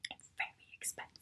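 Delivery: dropped to a whisper; whispered aside. Real, not acted. Whispering that the program costs too much. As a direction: whisper it like a secret.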